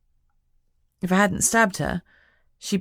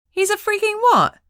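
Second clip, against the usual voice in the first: much higher, loud disbelieving shriek; shocked; 6 dB louder than usual. A narrator's reading. Lucy hearing her friend's boyfriend is a dragon, shocked shriek.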